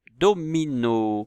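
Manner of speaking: drawn-out teacher's dictation; syllable by syllable. Shown as a demonstration. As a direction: sound the word out for young learners.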